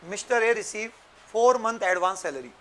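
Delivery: very slow, spaced-out dictation; dictating. Shown as a demonstration. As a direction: read each phrase slowly for notes. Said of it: "एक question लिख लो बच्चों".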